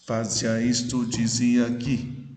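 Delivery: slow "Fazia isto, dizia aqui", syllables evenly marked; syllable counting. Shown as a demonstration. Teacher repeating the line for students, slow scansion of "Fazia isto, dizia aqui". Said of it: "Oito sílabas poéticas".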